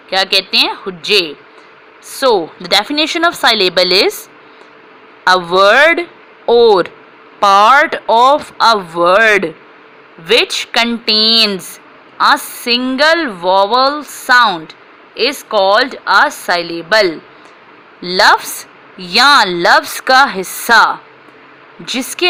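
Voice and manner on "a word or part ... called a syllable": slow dictation. Shown as a demonstration. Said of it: "teacher slowly gives definition".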